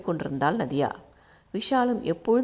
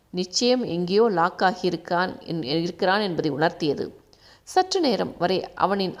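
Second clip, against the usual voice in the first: ordinary delivery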